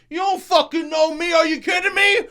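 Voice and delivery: very high, loud mock road-rage shout; yelling. Driver acting out yelling at people, mock furious shout. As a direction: scream it like a hammed-up furious driver. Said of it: "So I'm done yelling".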